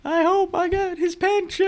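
very high, put-on wailing call; fleeing widower's cry. Widower calls while running away, high cry.

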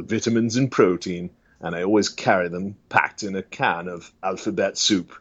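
British survival-show host; lightly voiced British adventurer; Bear Grylls impression. Bear Grylls presents survival tips, British accent. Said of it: "Bear Grylls is a very popular one".

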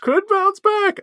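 high, teasing repeat; mocking echo. Echoing his friend's hope, high mocking repeat. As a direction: parrot it back in a high voice.

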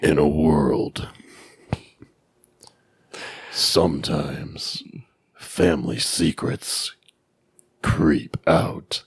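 low, slow trailer voiceover; drawn-out movie trailer narrator; haunting voice. Pretend. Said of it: "That was what it said in the trailer".

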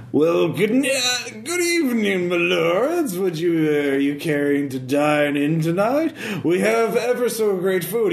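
fawning tipsy bum playing host; town drunk. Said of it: "he looks at you and says".

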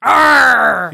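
loud, high pirate growl; hammed-up pirate cry; pirate "arr". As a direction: let out a big rolling "Arrr!". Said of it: "you know, pirate acting".

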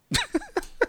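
short high-pitched laugh; a quick squeaky burst of laughter. Real, not acted. After saluting Chris Rock, a high laugh.

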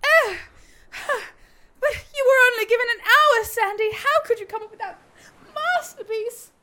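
high, loud, sobbing awe; overwhelmed gushing. Acted. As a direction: gush like you're moved to tears. Overwhelmed by Sandy's sign.

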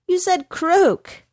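high, slow accusing horse voice. A narrator's reading. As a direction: pin the blame on him.

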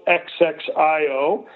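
X X I O said letter by letter; spelled out. Shown as a demonstration. Guest tells host the written name, spelling X X I O. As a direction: spell X X I O one letter at a time. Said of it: "but it's spelled".

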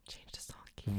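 whispered conscience voice; inner-voice whisper. Pretend. Voicing her Jiminy Cricket conscience in a whisper. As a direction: whisper it like a voice in your head. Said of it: "What's Jiminy Cricket saying to you?".

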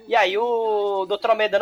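ordinary talk

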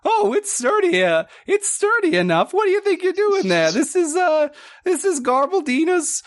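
high squeaky otter voice, indignant; gruff otter shipwright. Acted. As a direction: squeak it out like a fussy old otter.

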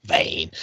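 VAN echoed low, soft and stretched; soft low copy of "van"; imitated pronunciation. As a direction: drop van low and draw it out. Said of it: "That's how he says it".

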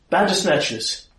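high-pitched lookout's alarm; alarmed twins. Acted. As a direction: cry out the sighting in a high voice. Twins cry out at sighted monsters.

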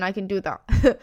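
normal talking voice

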